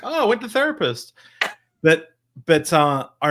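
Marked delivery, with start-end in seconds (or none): 0.0-1.1 breezy casual. Retold or imitated. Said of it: "this generation's like".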